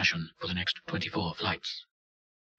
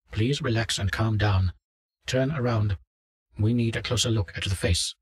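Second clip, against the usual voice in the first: louder, lightly voiced doctor giving orders; instructing doctor; 4 semitones higher, 7 dB louder than usual. Doctor over headset to a fleeing subject, lightly voiced instructing doctor. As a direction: raise the voice to direct a subject.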